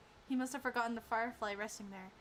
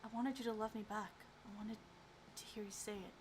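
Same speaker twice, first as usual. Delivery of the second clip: usual speaking voice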